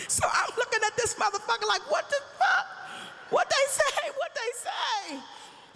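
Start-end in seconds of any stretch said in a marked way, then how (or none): 1.9-5.2 high, loud, stunned "what the fuck", last ask slowing right down; shocked disbelief. Retold or imitated. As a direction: go from a shriek of shock to a slow, dazed question. Shocked mother hears about voices.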